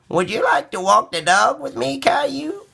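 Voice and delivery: high, gentle granny voice; grandma voice. A narrator's reading. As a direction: invite the boy like a kind grandma. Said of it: "Grandma said".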